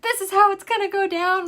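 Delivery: high, loud mock decree; husband's plan. Husband laying out the snake plan, loud mock voice. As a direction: lay down the law like the husband. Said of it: "He's like".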